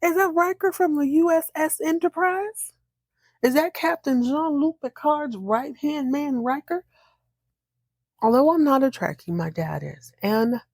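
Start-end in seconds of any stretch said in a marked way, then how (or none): 0.0-6.8 high, thrilled questions; excited recognition. Real, not acted. Fan recognizing Riker on screen, high and excited. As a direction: gush with disbelief over who it is. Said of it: "I said".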